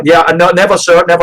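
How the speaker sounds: loud, high, hurried sorry; flustered apology. Acted. Leroy apologizing in a panic, loud and high. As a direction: scramble to apologize. NPC insists nervously.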